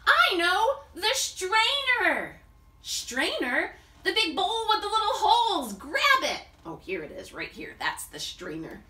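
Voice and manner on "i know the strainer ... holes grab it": higher and louder, eager; bursting with the plan; sudden idea. Acted. Idea of the strainer.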